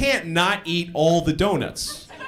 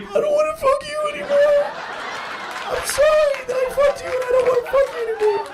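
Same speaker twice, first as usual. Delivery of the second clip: mock whiny crying. Comic begging the woman to let him go home, wailing. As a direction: wail it like a scared kid. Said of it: "Finally, I was like".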